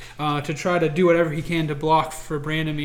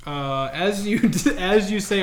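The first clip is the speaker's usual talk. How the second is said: ordinary talk, nothing marked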